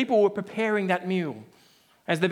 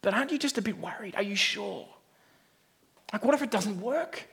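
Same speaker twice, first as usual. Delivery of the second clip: softer, anxious pleading; nervous father; 6 dB quieter than usual. Acted. Nervous father pleading with the confident one, softly anxious. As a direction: fret quietly, a bit fast. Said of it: "Then the nervous one is like".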